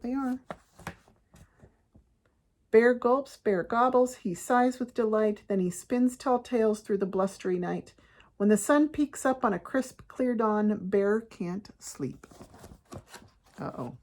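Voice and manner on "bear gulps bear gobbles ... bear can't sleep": lively picture-book reading, slowing at the end; story narration. A narrator's reading. Parent tells kids how bear feasts, storytelling. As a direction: read rhythmically, then draw out the last line.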